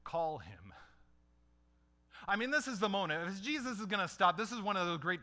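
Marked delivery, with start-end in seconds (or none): 0.0-0.7 gentle command. Acted. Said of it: "and said".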